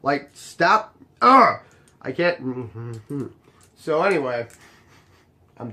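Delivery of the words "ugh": loud, higher "ugh" of annoyance; exasperated groan. Real, not acted. Venting about people waiting in cars, exasperated groan. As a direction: let out a loud, fed-up ugh.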